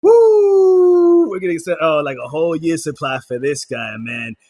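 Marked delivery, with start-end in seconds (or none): 0.0-1.3 high, loud wrestling-style woo; wrestler woo. Pretend. Host gets caught up in the wrestling act and whoops loudly. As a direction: let out a big pro wrestler woo. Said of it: "I don't know why I'm talking like this".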